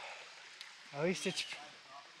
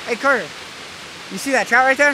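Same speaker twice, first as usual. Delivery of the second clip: calling out; 6 semitones higher, 6 dB louder than usual. Real, not acted. Calling friend across the creek.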